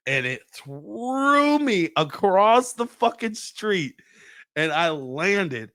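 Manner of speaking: stretched-out, high-pitched storytelling; slow, soaring account of being thrown; dramatic climax. Retold or imitated. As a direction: drag out the key words like slow motion. Climax of being blasted across street.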